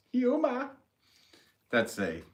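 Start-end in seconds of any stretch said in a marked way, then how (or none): 0.1-0.7 high-pitched Boston "hyoo-mah"; exaggerated Boston accent, high. Shown as a demonstration. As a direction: show off a Boston "humor", r dropped, pitched way up. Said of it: "referencing a Boston".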